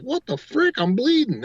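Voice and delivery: high-pitched re-enacted alarm; retold shock. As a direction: exclaim it high, acting out startled disbelief. Retelling own shock at bleeding.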